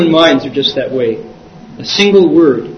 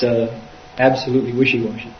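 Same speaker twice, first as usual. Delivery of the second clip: ordinary talk, nothing marked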